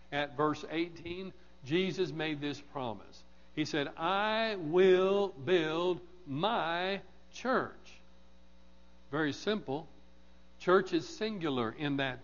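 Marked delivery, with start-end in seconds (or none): 4.0-7.7 very slow, word by word; drawn-out, deliberate proclamation; emphatic solemn quote. Retold or imitated. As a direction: weigh every word, a pause before "church". Quoting a promise with solemn emphasis.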